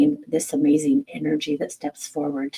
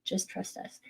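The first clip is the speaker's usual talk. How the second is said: the guides murmuring calmly inside her head; inner voice; 2 semitones higher, 14 dB quieter than usual. Retold or imitated. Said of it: "in my mind".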